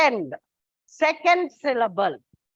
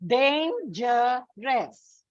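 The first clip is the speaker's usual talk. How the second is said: "Dangerous" very slowly split in three; drawn-out, separated syllables in "Dangerous"; much slower than usual. Shown as a demonstration. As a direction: sound out "Dangerous" as dan... ge... rous. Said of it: "second syllable".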